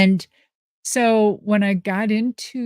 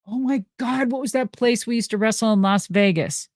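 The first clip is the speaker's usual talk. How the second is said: normal talking voice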